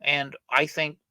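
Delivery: normal talking voice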